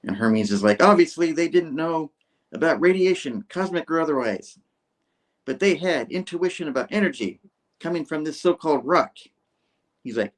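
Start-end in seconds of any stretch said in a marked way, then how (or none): 0.8-9.2 higher, insistent theorizing; hammed-up cameraman. Cameraman explaining ancient intuition, acted higher and insistent. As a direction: expound it in a pitched-up know-it-all character voice.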